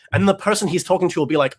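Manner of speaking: usual speaking voice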